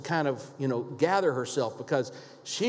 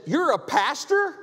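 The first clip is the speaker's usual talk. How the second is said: higher, slow astonished question; shocked question; 10 semitones higher than usual. Retold or imitated. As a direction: ask it in disbelief. Shocked woman recognizes a pastor.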